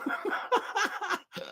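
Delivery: airy high-pitched laughter; laughing at the chat's guess; high breathless laugh. Real, not acted. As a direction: burst into wheezy laughter.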